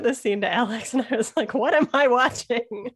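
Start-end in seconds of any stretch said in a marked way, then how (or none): 1.5-2.9 higher, louder, drawn-out mock bewilderment; retold disbelief. Telling what they sent to Alex, in mock disbelief. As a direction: stretch it out in exaggerated disbelief. Retelling own reaction to scene.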